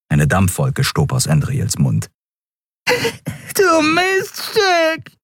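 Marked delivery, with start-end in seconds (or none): 2.9-5.2 very high, slow wailing insult with a cry; yowling. A narrator's reading. Dealer with broken nose cursing Endriel, yowling. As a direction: howl it clutching a broken nose. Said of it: "jaulte er".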